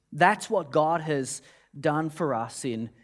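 ordinary delivery

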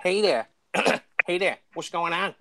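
lightly voiced goofy American cartoon dad; rough cartoon American impression; Peter Griffin. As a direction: have a go at a cartoon American accent. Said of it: "an impression of Peter Griffin".

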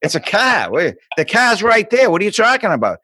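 put-on indignant Boston kid, "car" as "cah"; Boston accent. Boston kid arguing with Arizona classmates, thick Boston accent. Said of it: "You talk funny".

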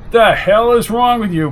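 loud, high, exasperated demand; exasperated outburst. Acted. Policeman to the howling suspect he holds, exasperated outburst. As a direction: burst out at a man acting crazy.

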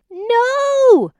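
high, loud shocked cry; cry of dismay. A narrator's reading. Mother spotting her toddler's mess, loud high cry. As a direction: yelp it out at the mess. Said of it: "she cried".

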